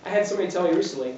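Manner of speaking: normal talking voice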